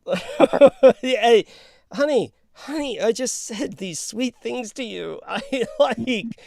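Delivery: high, loud, laughing mock-hurt croon; fake sweet voice. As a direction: protest sweetly in a hammed-up lover's voice. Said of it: "They don't like your fake voice".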